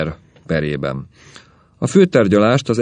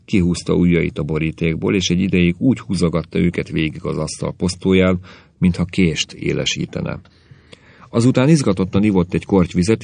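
ordinary delivery, nothing marked

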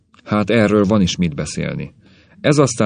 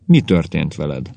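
plain reading voice

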